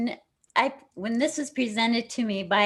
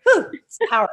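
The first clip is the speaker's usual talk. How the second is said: excited whew; 8 semitones higher, 9 dB louder, faster than usual. Real, not acted. Excited exhale at a powerful moment.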